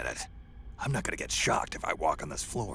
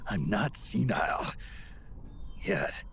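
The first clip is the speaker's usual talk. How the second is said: grumpy insistence; 4 semitones higher than usual. Acted. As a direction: stress on "not", clipped and irritated. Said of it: "I told you".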